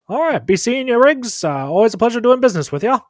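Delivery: high, loud, brisk doctor voice; cheery send-off, pitched well up; doctor character. Acted. Doctor seeing off his patient, high and loud. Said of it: "and says".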